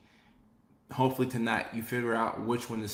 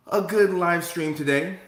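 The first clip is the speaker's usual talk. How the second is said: hyped up; 6 semitones higher, 7 dB louder than usual. Real, not acted. Host hyping the stream.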